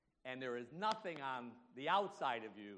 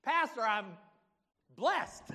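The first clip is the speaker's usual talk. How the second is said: high, loud acted testimony; exultant shouted declaration; triumphant answer; 10 semitones higher, 13 dB louder, much slower than usual. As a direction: belt out the reply to the pastor, pausing before the last word. Acts Nate's triumphant answer.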